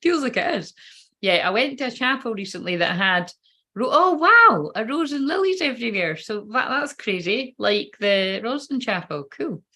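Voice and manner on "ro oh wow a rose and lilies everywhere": sudden high gasp of wonder; a real delighted wow; surprised. Real, not acted. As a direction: catch your breath at the sight. Spots a rose in the cards.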